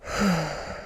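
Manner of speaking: long, soft sigh as the tension goes; deep sigh of relief. Shown as a demonstration. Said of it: "like a deep sigh of relief".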